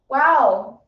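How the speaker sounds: ordinary talk, nothing marked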